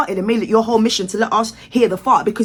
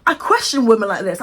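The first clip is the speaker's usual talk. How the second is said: ordinary delivery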